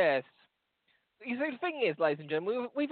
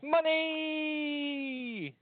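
long high call of Money; high drawn-out cry; 10 semitones higher than usual. Pretend. The Englishman in the joke shouting for money as he slides. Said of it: "he goes".

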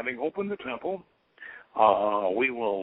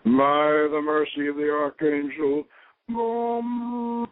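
solemn, louder intoning, last name drawn out very slowly; grave ceremonial calling of the watchtower; ritual invocation; 3 semitones higher, 3 dB louder than usual. Real, not acted. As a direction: invoke the angels of the west with ceremony.

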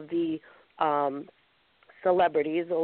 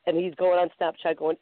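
normal talking voice

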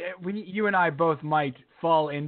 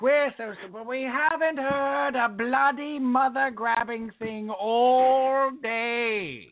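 slow, high, mock-posh British sing-song; slow British crooning parody; 7 semitones higher, much slower than usual. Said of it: "it's just so British".

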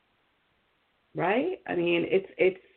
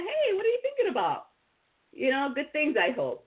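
much higher, sing-song friendly question; cheery check-in; 7 semitones higher than usual. Retold or imitated. Host voicing a friend checking in, high and cheery.

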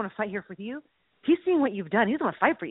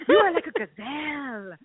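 movie quote voice. Acted. Quoting movie character.

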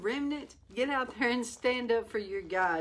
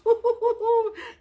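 excited laugh; 8 dB louder than usual. Real, not acted.

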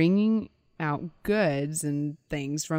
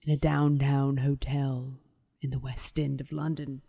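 spooky storyteller. Acted. Podcaster setting the scene of a London murder, spooky storyteller. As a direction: draw out the murder tale with hammy suspense.

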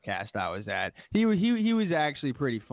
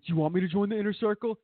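much higher, fast parroting echo; mocking echo; 8 semitones higher, faster than usual. Young heel mocking Y2J's offer back, high fast squeak. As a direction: throw the question back in a squeaky mock voice. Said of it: "And he was like".

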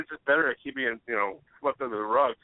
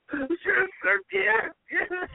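very high, strained, squealing comic voice; Bobcat Goldthwait; much slower than usual. Retold or imitated. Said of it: "I tried to do a Bobcat".

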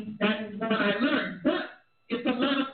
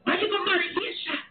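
scornful shouted quote; 7 semitones higher than usual. Retold or imitated. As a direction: yell it like scandalized family. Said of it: "and they were like".

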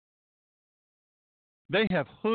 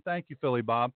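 usual speaking voice

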